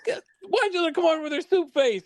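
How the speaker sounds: put-on a hulking boxer shrieking like a little girl; panicked big man. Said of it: "he screams like a little girl".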